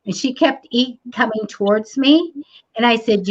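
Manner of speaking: ordinary delivery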